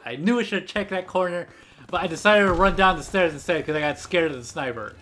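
ordinary talk

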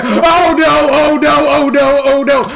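loud, high repeated cries of dread; panicked wail. Real, not acted. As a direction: wail helplessly as danger closes in. Attacked in the game.

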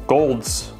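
ordinary talk, nothing marked

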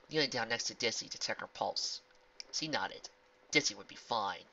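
plain reading voice

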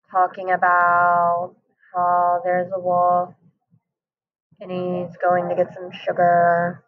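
low, slow monotone with long pauses; unexcited. Shown as a demonstration. Teacher showing a book talk without excitement, low, slow monotone. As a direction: drone it out, sullen or sad. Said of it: "doesn't really sound like I'm excited. It sounds like I'm mad or angry, maybe sad".

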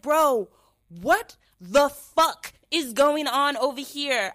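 loud, high, slow disbelief; hammed-up retold fury; exasperated outrage. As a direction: spell out your outrage slowly and loudly.

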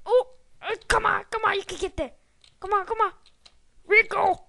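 high, loud, pleading urging; will the character across the gap; tense urging. Real, not acted. Gamer pleads with the character not to fall, high and loud.

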